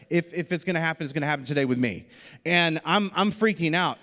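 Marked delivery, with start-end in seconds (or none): none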